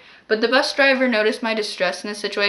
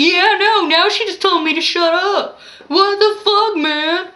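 put-on much higher, louder drunk rant; drunk man ranting; 9 semitones higher, 6 dB louder than usual. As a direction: yell like an offended drunk.

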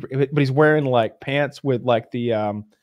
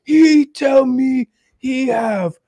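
high, slow ape announcement; the ape revealing the last twist; 11 semitones higher, 7 dB louder, much slower than usual. Retold or imitated. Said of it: "he's like".

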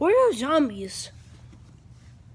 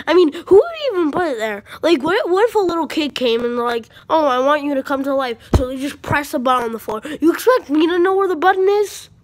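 loud, higher-pitched mock outrage; play-acted exasperated tirade, loud; indignant rant; 4 semitones higher, 9 dB louder than usual. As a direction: rant in disbelief, raising the voice throughout.

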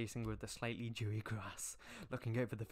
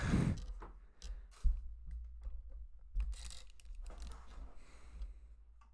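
long, airy, helpless laugh; cracking up, nearly silent, for seconds; long breathy laugh. Real, not acted. Laughing at the fanfic.

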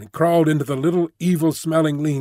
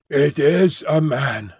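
usual speaking voice

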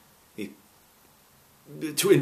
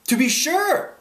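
high, loud, rising exclamation; eager assent; 5 dB louder, slower than usual. A narrator's reading. Canby answering lost travellers, eager and high.

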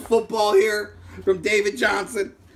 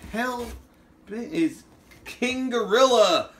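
ordinary talk

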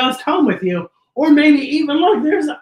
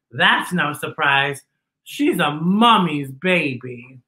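plain reading voice

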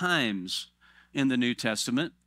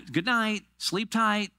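higher, perfunctory mock bedtime send-off; pit stop parent; 8 semitones higher, slower than usual. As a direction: sign off like a busy parent. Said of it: "doing pit stop parenting".

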